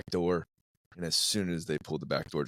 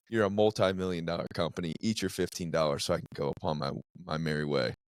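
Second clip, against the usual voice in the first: ordinary delivery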